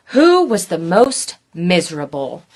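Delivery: usual speaking voice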